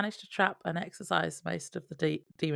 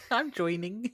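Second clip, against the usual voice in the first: laughing; 2 semitones higher than usual. Real, not acted. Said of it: "I said join-ning-ning-ning".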